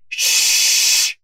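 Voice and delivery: very loud, long "shh"; forceful shushing. A narrator's reading. Librarian silences the detective's fanfare, shushing forcefully. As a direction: cut the noise off with a huge hush.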